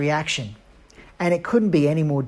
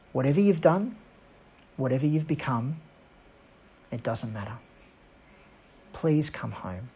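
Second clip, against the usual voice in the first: tender plea; 4 semitones lower, 7 dB quieter than usual. A narrator's reading. Father's note read tenderly.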